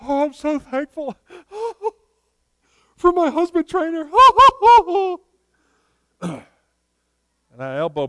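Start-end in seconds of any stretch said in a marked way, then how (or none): 0.0-4.1 much higher, put-on teary gushing; imitating teary sister. Brother mimicking his sister's Thanksgiving tears, high and gushing. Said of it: "starts crying and says". 4.1-5.2 high giggling laugh. Retold or imitated. Sister imitation dissolves in laughter.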